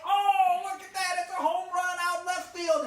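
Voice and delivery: high, loud crowing; hammed-up shouted bragging; boastful excitement. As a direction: yell it like a wild home run call. Boy boasts of his own hit.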